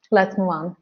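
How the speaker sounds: ordinary talk, nothing marked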